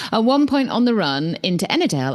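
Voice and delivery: normal talking voice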